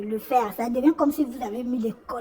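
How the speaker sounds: ordinary delivery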